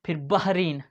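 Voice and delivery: ordinary talk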